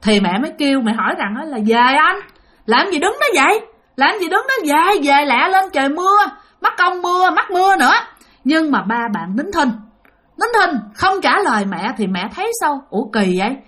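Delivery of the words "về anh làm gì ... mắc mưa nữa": high-pitched urging, stretched words; hurrying someone along in a higher voice; calling to husband. Retold or imitated. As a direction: call him over, raised pitch. Mother calls silent husband to hurry.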